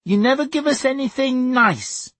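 put-on very high child's complaint; high, whining little-boy accusation; Sonny. A narrator's reading. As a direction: wail it like an aggrieved small boy. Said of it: "Sonny said".

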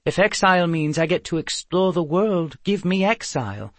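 lightly voiced adventurous girl; spirited Eve. Eve welcomes exile to explore the world, spirited.

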